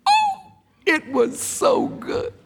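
high, drawn-out swoon of delight; savoring delight. Real, not acted. Preacher relishing his childhood barbecue, high savoring delight. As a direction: let it soar slowly, relishing the memory.

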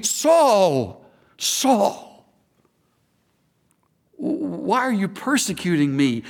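a lightly voiced heavenly caller, drawn-out falling calls; voice of Jesus calling. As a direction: call the name twice like a voice from heaven. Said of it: "He called me".

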